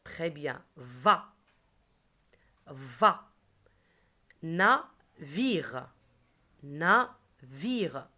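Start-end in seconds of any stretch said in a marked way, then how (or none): 0.8-1.3 "Va" alone, loud and lower; "Va" fired out for pupils to copy; isolated syllable. Shown as a demonstration. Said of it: "Qu'est-ce qu'on va répéter?". 2.7-3.3 "Va" alone, loud and lower; "Va" fired out for pupils to copy; isolated syllable. Shown as a demonstration. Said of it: "Qu'est-ce qu'on va répéter?". 4.4-8.1 syllable by syllable. Shown as a demonstration. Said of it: "On va taper combien de fois?".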